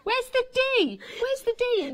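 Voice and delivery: high, loud, indignant challenge; indignant demand. Real, not acted. Mock quarrel over pronunciation.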